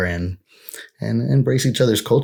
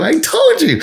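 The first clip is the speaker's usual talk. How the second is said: high, loud I-told-you-so; 5 dB louder than usual. Retold or imitated. Scolding friends whose water went hot, loud and high. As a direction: rub it in triumphantly.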